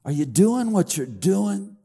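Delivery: ordinary talk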